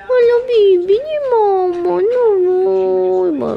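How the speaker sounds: very high, loud gushing coo. Real, not acted. Owner gushing at her cat in high loud baby talk. Excited greeting to pet.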